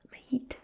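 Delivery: "head" said as "heid", rhyming with need; Glaswegian head. Shown as a demonstration. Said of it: "Rather than head".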